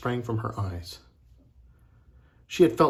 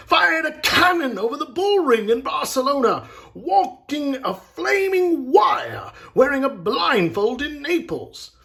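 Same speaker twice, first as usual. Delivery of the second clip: loud, high showman's list of marvels; awed boasting; 13 semitones higher, 6 dB louder than usual. A narrator's reading. Agent lists Bellini's daring feats to the others, excited. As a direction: build each feat bigger, high and loud. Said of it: "the agent said".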